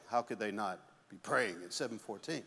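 hushed, low mock piety; smug judging voice. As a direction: look down your nose, quietly. Mocking a self-righteous churchgoer.

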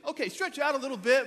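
high, loud mock instructor; hammed-up trainer giving orders; imitated CrossFit coach. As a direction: bark it high like a peppy coach. Acts out CrossFit coach's first instruction.

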